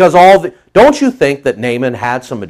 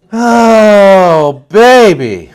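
excited exclamation; 7 semitones higher, 8 dB louder, much slower than usual. Real, not acted. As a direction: savor it with relish. Preacher exclaims.

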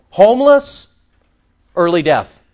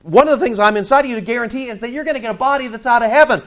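higher, fast, eager inner voice; excited voice of the Spirit within, higher and quick; voicing the Holy Spirit; 6 semitones higher, 3 dB louder, faster than usual. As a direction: speak as the Spirit urging from inside. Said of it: "He's saying".

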